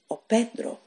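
ordinary delivery

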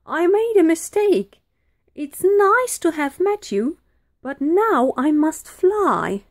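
high, loud panicky excuses; flustered spluttering. A narrator's reading. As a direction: back out nervously as a scared dragon. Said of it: "he spluttered".

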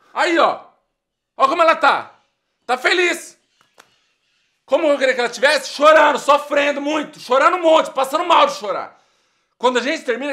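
loud, high, outraged yelling; furious shouting. Acted. Dumped guy to his friend, furious loud shouting. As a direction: rage at the happy photo of her.

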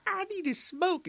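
put-on high, slow falsetto girl; mock girl voice. Girlfriend dodging the jock, slow high falsetto whine. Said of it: "She's like".

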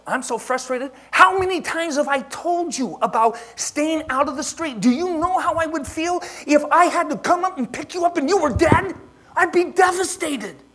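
high, loud frantic shouting. Retold or imitated. Father shouting at son who ran into the street, loud and high. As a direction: yell it at the kid in panic. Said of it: "I was shouting at him".

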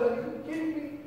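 disbelief. Retold or imitated. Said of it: "I thought".

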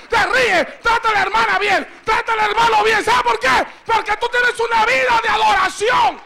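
loud, driving sermon voice; fiery preaching. Acted. Preacher drives the congregation to worship. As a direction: preach it with full force.

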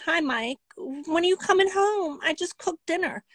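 mock the hopeful wife calling her man; wife calling cheating husband, high and sweet; sweet wife on the phone. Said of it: "You'd be like".

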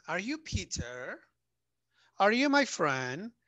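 "Are you Peter? Are you my friend?" slow, climbing up at the end; rising tune. Shown as a demonstration. Teacher models yes-no questions for students, slow and rising. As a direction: let yes-no questions rise, slowly. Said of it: "dependiendo de lo que contestamos".

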